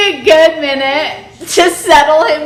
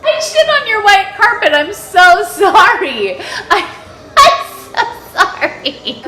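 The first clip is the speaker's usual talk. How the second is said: laughing apology; 6 semitones higher, 5 dB louder than usual. Real, not acted. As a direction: say sorry while giggling. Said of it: "I'm laughing so hard".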